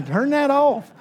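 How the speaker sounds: laughing through a quick request. Real, not acted. Preacher laughing as a phone interrupts the sermon.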